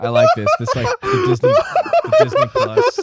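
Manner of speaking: high cackling words, half laughed; witch laugh. Real, not acted. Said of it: "Oh, that's my witch laugh".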